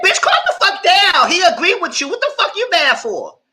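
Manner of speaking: loud, high-pitched acted telling-off; hammed-up yell, slowing at the end; exasperated scolding. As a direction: shout him down in exasperation. Acting out own scolding of him.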